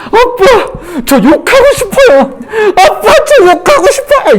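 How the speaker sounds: put-on very high, wailing kid voice; imitating crying child. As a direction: bawl like an upset child to dad. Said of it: "막 엉엉 울면서 저한테 그러는 거예요".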